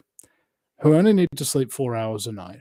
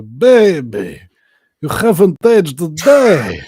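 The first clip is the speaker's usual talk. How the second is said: hammed-up Russian accent, louder and higher, laughing through it; 9 semitones higher, 9 dB louder, slower than usual. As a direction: greet your wife and daughter in a thick mock Russian accent like a freed gulag prisoner. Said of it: "Good accent".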